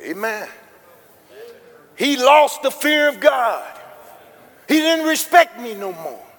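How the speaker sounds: preacher's full-force wail; preaching. Acted. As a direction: build it up to a shouting climax. Preacher builds to a shout.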